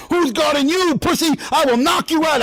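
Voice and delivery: acting tough toward Big Pussy on The Sopranos, aggressive and pitched high; aggressive tough guy. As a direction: get in his face and threaten him. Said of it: "then the aggressive one was me and Big Pussy".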